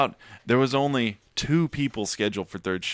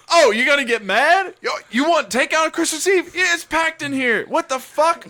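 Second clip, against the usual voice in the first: much higher, put-on voice of a tough dishwasher yelling down the phone; 4 dB louder than usual. Imitating the dishwasher chewing out a takeout caller, high and brash. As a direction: snap at the caller, high and cocky. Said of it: "turned into [name]".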